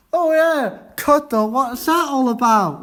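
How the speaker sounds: high, loud, hammed-up disbelief; a pupil making fun of the name coddle, high and loud; mock amazement. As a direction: play up how weird the dish sounds.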